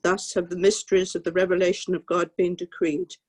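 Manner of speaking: ordinary talk, nothing marked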